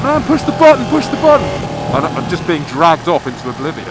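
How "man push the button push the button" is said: high, loud urgent shout; desperate yell. Retold or imitated. To a bloke near the switch, desperate shout. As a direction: yell at the stranger to hit the button. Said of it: "I was like".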